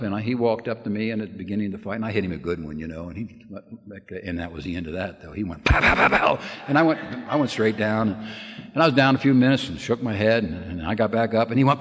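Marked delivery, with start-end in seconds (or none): 5.6-6.4 breathy mock punch sounds, louder; mimicking a flurry of punches; punch noises. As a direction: spit out each pow like a blow landing. Retelling punches landed in the fight.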